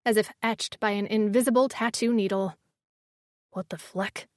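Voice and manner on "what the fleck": low, soft mutter. A narrator's reading. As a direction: mutter it under your breath. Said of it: "Miko muttered".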